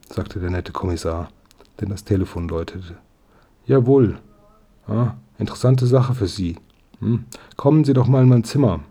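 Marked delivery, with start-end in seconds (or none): 3.7-8.8 lively, higher-pitched phone replies; one side of a phone call, raised and energetic; talking into telephone. A narrator's reading. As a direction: answer a caller in short, brisk bursts. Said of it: "sprach er in den Apparat".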